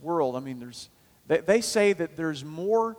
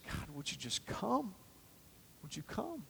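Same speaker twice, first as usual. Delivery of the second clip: much softer, a murmured longing prayer; quiet plea; 4 semitones higher, 15 dB quieter than usual. Retold or imitated. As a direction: breathe it like a tired prayer. Quoted plea to God.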